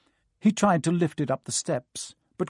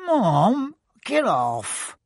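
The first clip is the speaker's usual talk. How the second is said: put-on whiny boy protest; 8 semitones higher, 5 dB louder, much slower than usual. A narrator's reading. Ron squirms away.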